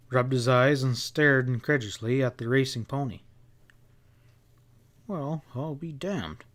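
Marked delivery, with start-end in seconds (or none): 5.1-6.4 muttered. A narrator's reading. Bill staring at the racing pony, muttering softly. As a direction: mutter it to yourself, stunned. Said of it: "he muttered".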